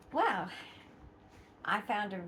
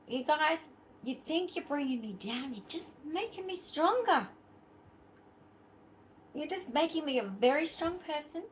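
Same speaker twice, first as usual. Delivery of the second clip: defiant; 5 semitones higher than usual. Real, not acted. Defiant message to online critics.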